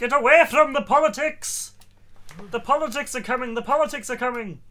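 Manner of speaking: high, loud hammed-up alarm; mock panic shout. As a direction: shriek a fake warning. Mock panic about politics.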